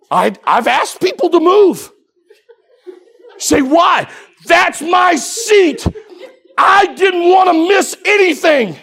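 loud, high, driving preacher's cadence; full-force preaching. Acted. Preacher tells the congregation about the house of God, full-force preaching. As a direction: preach it to the rafters.

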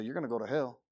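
restrained, conversational delivery; kind telling. Shown as a demonstration. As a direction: break hard news to someone calmly. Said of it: "we can be kind in how we present it".

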